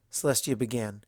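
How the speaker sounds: usual speaking voice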